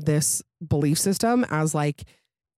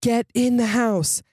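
dad's yelled order, higher and slow; mild yell; 6 semitones higher, slower than usual. A narrator's reading. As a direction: call it out as a drawn-out, higher command. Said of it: "my dad yells down at us".

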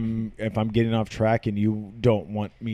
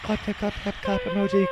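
higher, softer chanted clap clap; mock applause; 8 semitones higher, 4 dB quieter than usual. Boyfriend chanting the claps as applause, higher and softer. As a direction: say the claps like a bored emoji. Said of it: "Insert applause".

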